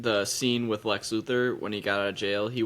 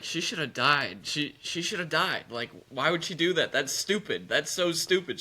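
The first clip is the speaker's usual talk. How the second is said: higher-pitched, imitated outrage; mock indignation; 6 semitones higher than usual. Imitating Cameron mad at this scene, mock indignation. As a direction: protest like an exasperated viewer, half in fun. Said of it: "She was so mad at this scene".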